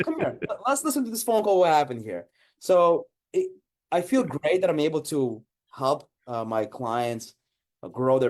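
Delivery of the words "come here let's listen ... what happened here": mock coaxing. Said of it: "And I tell them".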